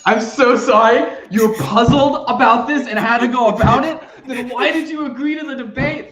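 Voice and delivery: high, laughing incredulity; can't believe it, laughing it out; laughing disbelief. Real, not acted. Cohost reacting to the debater's puzzled opening, laughing disbelief. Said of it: "get it out of your system".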